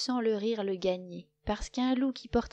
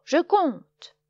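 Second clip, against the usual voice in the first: shout; 9 semitones higher, 10 dB louder than usual. A narrator's reading. Loup shouts.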